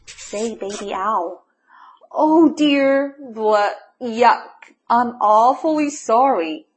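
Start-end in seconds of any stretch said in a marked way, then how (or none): 2.1-6.6 loud, broken-up apology; spluttering. A narrator's reading. Mole who kissed a tree, spluttering. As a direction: splutter out disgust, then apologise. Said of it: "spluttered Morris".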